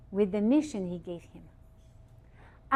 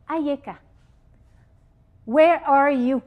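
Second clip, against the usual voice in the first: calling out; 3 semitones higher, 7 dB louder than usual. Acted. God calls out to Adam.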